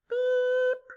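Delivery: "beep" squeaked high for the blank. Shown as a demonstration. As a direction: pop a high beep where the number is missing. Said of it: "let's see what fits".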